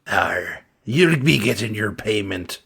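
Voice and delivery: lightly voiced pirate; a hint of a pirate; mild pirate accent. Pirate character replying.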